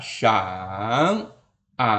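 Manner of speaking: SHANG and A dipping low then rising; third tone. Shown as a demonstration. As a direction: sink low and rise on SHANG, A. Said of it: "ini nada ketiga". (SHANG, A nada ketiga, turun lalu naik)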